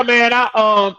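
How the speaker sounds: usual speaking voice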